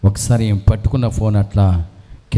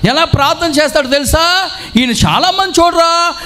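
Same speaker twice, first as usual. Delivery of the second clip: much higher, loud tipsy shouting; drunk man shouting; 3 dB louder than usual. Retold or imitated. Drunk wedding guest loudly praises the father, shouting. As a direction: bellow praise like a happy drunk. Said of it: "అనేసరికి ఏమన్నాడు".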